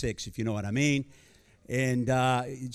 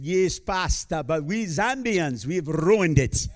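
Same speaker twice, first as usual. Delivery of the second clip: slow, higher imitation of a Zambian leader's English accent; 4 semitones higher, 4 dB louder, slower than usual. Zambian leader teasing the pastor in an imitated accent. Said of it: "one of our leaders said".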